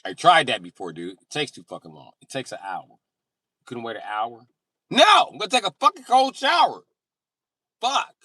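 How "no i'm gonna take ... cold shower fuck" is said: high loud stubborn shouting; indignant shout. Retold or imitated. Friend's indignant reply.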